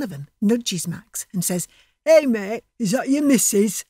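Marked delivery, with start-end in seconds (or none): 2.1-3.8 louder jeering taunt; mocking thug. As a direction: sneer at him like a lout.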